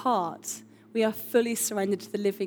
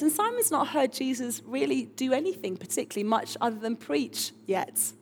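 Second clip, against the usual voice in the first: normal talking voice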